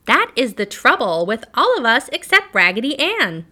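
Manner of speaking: high, loud, squeaky little doll; squeaky penny doll. A narrator's reading. Penny doll crying out about the others, squeaky voice. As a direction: squeak it out like a tiny toy. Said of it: "cried the little penny doll in a squeaky voice".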